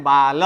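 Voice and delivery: बाल as baa-la, loud and low, both syllables clear; full final vowel. Shown as a demonstration. Teacher correcting students' habit, full final vowel. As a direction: sound the a after the l in बाल. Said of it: "कैसे बोलना है?".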